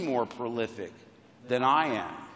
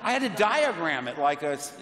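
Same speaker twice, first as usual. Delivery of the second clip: ordinary delivery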